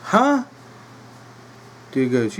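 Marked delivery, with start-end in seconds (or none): 0.1-0.5 confused huh. Retold or imitated. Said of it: "Dilly goes".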